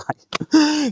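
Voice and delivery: short high laugh of disbelief; a quick overwhelmed laugh; giddy high laugh. Real, not acted. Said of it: "Guys, I'm gonna cry".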